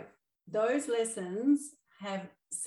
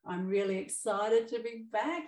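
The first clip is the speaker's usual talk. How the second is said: bright excited voice, louder, smile heard; smiling excited; 4 semitones higher, 6 dB louder than usual. Real, not acted. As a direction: say it beaming with a smile. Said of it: "You can hear the smile in my voice".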